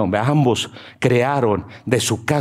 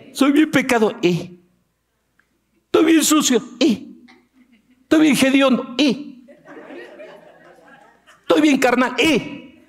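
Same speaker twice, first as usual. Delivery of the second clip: much higher, mock whining excuses; high whiny sinner; 14 semitones higher than usual. Sinner whines excuses to God, much higher.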